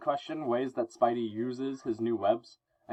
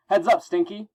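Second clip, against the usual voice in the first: high, loud heads-up; taunting call; 7 semitones higher, 5 dB louder than usual. Acted. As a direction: call out a cocky warning to the villain. Spidey taunting the Goblin.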